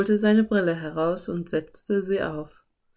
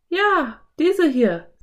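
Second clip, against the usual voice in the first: put-on high, louder girl character voice; Anne; 10 semitones higher, 6 dB louder, much slower than usual. A narrator's reading. Anne shows Philipp the shell, high loud voice.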